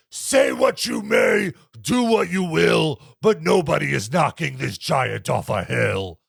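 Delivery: deep, slow booming giant voice; dopey cartoon bellow. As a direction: boom it out like a dumb giant. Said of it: "the Giant says".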